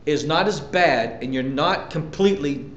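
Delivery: ordinary talk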